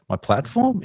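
high, baffled "My platform?"; surprised echo. Retold or imitated. Guest recalls being asked about his platform, high puzzled echo. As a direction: throw the word back in disbelief. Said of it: "And I'm like".

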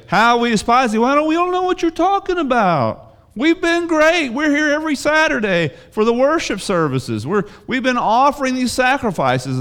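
high, indignant whiny protest; mock protesting priests. Preacher mimicking priests, mock-offended whine. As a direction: play the priests acting offended and innocent. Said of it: "but you say".

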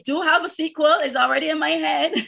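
amused, laughing at the end. Real, not acted. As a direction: say it high, ending in a laugh. Joking about her unwritten sequel.

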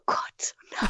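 breathy whisper of despair; mock-tearful whisper after the baby's kick; whispered dismay. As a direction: whisper it like crying harder. Crying harder in the scene.

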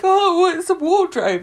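high-pitched, sheepish answer; mock timid. Scared customer answers the recycling worker, mock timid. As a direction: act out a nervous customer.

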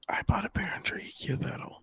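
whispered confession; hushed, secretive whisper; worried whisper. Real, not acted. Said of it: "Why are we whispering?".

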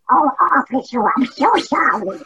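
high quacking duck voice; Donald Duck voice. Pretend. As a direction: apologize like a cartoon duck. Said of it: "I would say".

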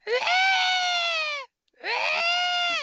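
a high, loud mock scream of terror; imitated scream. Telling friends about a scary night, mock screaming. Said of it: "it sounded like somebody screaming".

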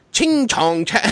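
high mock gibberish counting; fake Chinese counting. Boy showing the class his fake Chinese, high and mocking. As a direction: ham up a kid's fake Chinese. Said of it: "he thought it was Chinese".

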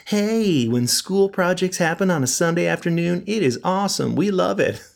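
high-pitched mock enthusiasm; fake cheery. Host mocking how school projects sound on Sundays, fake cheery. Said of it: "trying to make it sound like".

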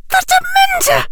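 high, loud terrified cry; shrieking. A narrator's reading. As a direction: shriek it in terror. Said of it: "Her voice rose to a shriek".

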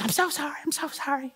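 tiny high, soft apology; imitated apologetic wife. Wife who turned on all the lights, imitated meek apology.

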